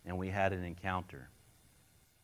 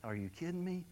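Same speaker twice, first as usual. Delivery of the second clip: higher, softer, mock-incredulous; half-laughing at your own mistake; stunned disbelief; 9 semitones higher, 5 dB quieter than usual. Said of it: "I'm over here going".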